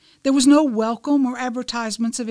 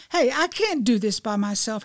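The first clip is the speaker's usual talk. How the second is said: ordinary delivery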